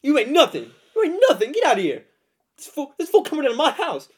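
loud, high tough-guy shouting; Mister T impression. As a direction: yell threats like a tough brawler.

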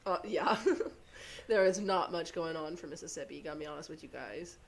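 usual speaking voice

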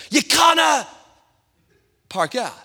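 put-on shouted high in a Scottish accent. Traffic warden yelling at him in Scottish accent. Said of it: "I'll tell you how it sounds".

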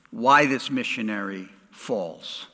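ordinary talk, nothing marked

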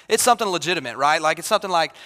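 ordinary talk

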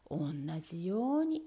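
plain reading voice

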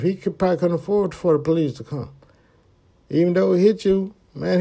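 lightly voiced conscience; inner voice. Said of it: "I'm thinking like".

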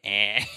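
"Eh" as a sharp Michigan A. Shown as a demonstration. Showing how family from Michigan say A, "Eh" with a sharp vowel. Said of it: "like, sharp A's".

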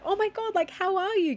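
imitating an overjoyed guy greeting her; mock excited greeting. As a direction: gush it like a surprised friend. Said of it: "he jumps up and he's like".

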